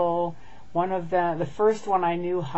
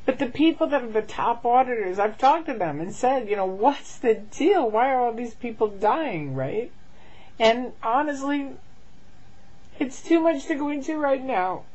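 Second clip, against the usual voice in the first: crying through the words, voice breaking high; real tears, pushing words out between sobs; blubbering; 6 semitones higher than usual. Real, not acted. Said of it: "And I'm sorry I'm crying".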